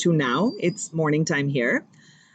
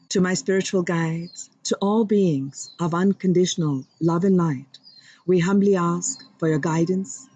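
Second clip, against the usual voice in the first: humble prayer. Acted. Host invoking God and guides for viewers, reverent prayer voice. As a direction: pray humbly and sincerely. Opening prayer to divine beings.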